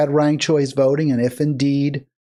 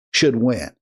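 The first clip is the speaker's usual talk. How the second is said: normal talking voice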